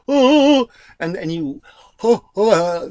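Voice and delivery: loud high operatic "oh"; mock opera. Shown as a demonstration. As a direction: burst out like an opera singer. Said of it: "the opera thing".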